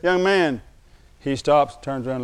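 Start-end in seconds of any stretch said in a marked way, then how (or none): 0.0-0.6 loud call across the street; shouting to get his attention. Retold or imitated. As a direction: call after him down the street. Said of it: "And I yelled at him".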